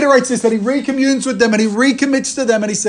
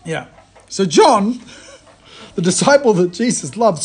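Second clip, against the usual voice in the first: ordinary delivery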